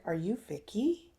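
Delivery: softly whispered, unsure of the name; hushed question. Retold or imitated. A stranger at Starbucks asks Vicky, in a whisper. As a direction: lean in and whisper it as if checking.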